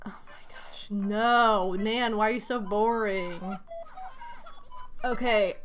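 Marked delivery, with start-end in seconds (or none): 0.0-0.9 softer whisper under the breath; whispered aside. Real, not acted. Player whispering to herself at a boring villager, hushed. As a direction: breathe it out in disbelief. Muttered disbelief.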